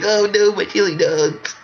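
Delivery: high slow mock wail; whiny Sonic voice.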